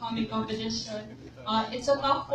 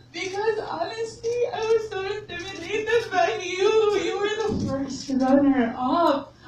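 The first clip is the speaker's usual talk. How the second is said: higher, louder, voice breaking into tears; laughing cry; 7 semitones higher, 5 dB louder, slower than usual. Real, not acted. Friend recalling first meeting Pia, laughing through tears.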